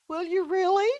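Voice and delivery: high, little put-on female voice; Delilah. Delilah hearing Samson will tell, high and hopeful. Delilah brightens through tears.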